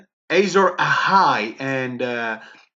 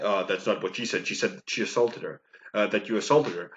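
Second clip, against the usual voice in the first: much lower, much softer officer voice; flat bored policeman reading the charge; deadpan cop; 7 semitones lower, 6 dB quieter, faster than usual. Acted. Officer telling the arrested guy the charge, low deadpan.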